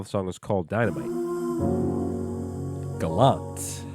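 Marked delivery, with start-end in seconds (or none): none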